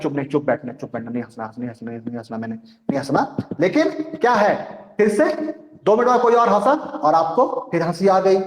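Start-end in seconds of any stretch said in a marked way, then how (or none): 0.0-3.4 much softer, lower, telling yourself not to laugh; a student in class quietly ordering herself to keep a straight face, hushed; hushed self-talk. Retold or imitated. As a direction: hush it under your breath like a student holding back laughter. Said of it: "फिर आप सोच रहे हैं".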